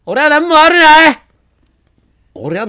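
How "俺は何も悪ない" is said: hammed-up loud self-defence; mock indignant yelling. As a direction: blame the enemy loudly, half joking. Shouting blame at the zombie.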